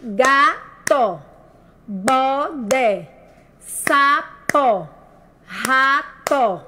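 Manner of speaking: each word of "Gato, bodé, sapo, rato" split in two with a gap; GA... TO, BO... DÉ, SA... PO, RA... TO, evenly spaced; separated syllables. Shown as a demonstration. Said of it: "com as sílabas separadas".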